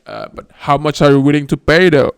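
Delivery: loud hammed-up haggling president; mock bribe ask. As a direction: push for a bribe.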